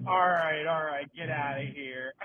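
police officer. Retold or imitated. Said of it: "the police officer's like".

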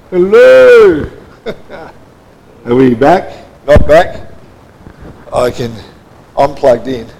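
ordinary talk

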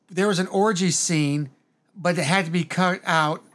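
slow, measured read of a tweet. Retold or imitated. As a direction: read it slowly, deliberately. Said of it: "It says something like, paraphrasing".

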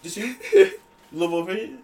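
high, fast taunting gamer voice; mocking gamer voice. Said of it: "He's like".

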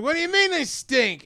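high, indignant sassy retort; mock-offended monster snapping back. Podcast host voicing the stink monster, sassy and high. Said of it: "sounded like it was Harvey Fierstein".

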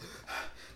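imitated breath on the run; panting breath imitation. Man retells running through a park, imitated heavy breath. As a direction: huff out a voiceless runner's breath. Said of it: "hearing my breath".

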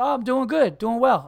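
eager small-talk reply; cheery reply. Retold or imitated. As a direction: answer brightly, playing up the friendliness. Replying cheerily to friendly lady.

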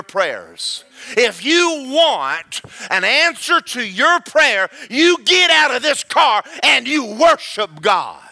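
commanding pulpit declaration; the Lord. Acted. Delivers the Lord's words to him.

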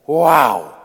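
loud astonished exclamation; stunned preacher's wow; awed wow. Acted. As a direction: let the awe burst out. Exclaims after scripture.

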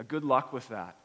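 ordinary delivery

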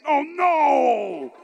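drawn-out "no" sliding downward; hammed-up cry of dismay; mock dismay. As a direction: wail it in comic horror. Acts dismayed reaction to jacket comment.

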